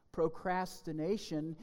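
ordinary talk, nothing marked

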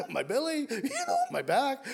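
high, laughing through the words. Acted. Peter eagerly begging to be washed, laughing. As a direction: laugh while piling it on.

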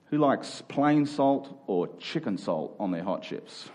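usual speaking voice

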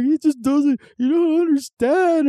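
high, drawn-out mock blubber; imitated drunken crying. Crying brother complaining to a bystander, hammed-up weepy wail. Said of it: "one of them started crying".

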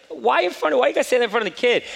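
high, fast, incredulous complaint at the doctor; why would you say that, rising in disbelief; exasperated protest. Retold or imitated. Father protesting to the doctor in front of his son, exasperated. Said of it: "I'm like".

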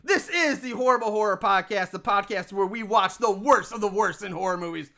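ordinary delivery

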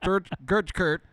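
put-on mumbling Texas Southerner accent; mock mumbling accent. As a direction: keep up the lazy Texas mumble on the name. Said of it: "I have trouble not talking like that".

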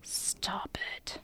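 barely voiced, very soft whisper; hushed little order; whispered aside. Real, not acted. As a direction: whisper it under your breath. Whispered aside during the reading.

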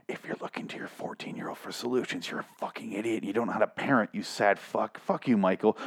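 very soft, contemptuous undertone; muttered aside. Real, not acted. Reader scolds the article's author, muttered aside. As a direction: mutter the insult under your breath.

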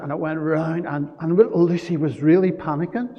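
ordinary talk

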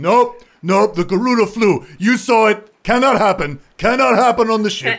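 high, loud stubborn refusal; Zandros's voice. Acted. Zandros refusing Tavar, loud stubborn voice. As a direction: dig in and refuse, loudly. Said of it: "He's like".

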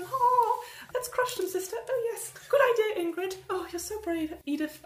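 very high prissy sister voices; smug sisters. Retold or imitated. Rival sisters praising each other in high voices. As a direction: gush like silly villains. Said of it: "are laughing like".

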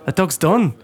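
lightly put-on Irish lilt; mock Irish accent. Passer-by writing off the stranded dog, mock Irish accent. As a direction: imitate an offhand Irish passer-by.

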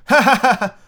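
high, loud hearty laugh imitating Peter Pan. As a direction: ham up the boy hero's big laugh. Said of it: "that's the delivery".

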